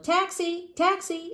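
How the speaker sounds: high, loud street hail; Taxi! Taxi! hollered high and loud; yelled for a taxi. Acted. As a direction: call the cab across the street. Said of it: "the circus man yelled".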